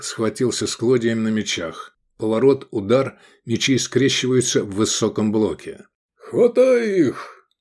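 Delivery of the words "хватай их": very high, drawn-out battle yell; booming roar. A narrator's reading. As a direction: roar the order across the fight. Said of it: "заревел чей-то зычный голос". (зычный протяжный рёв)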